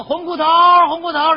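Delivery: high teasing call across the lane; calling out a nickname. Retold or imitated. Neighbours calling the father by his nickname, teasing shout.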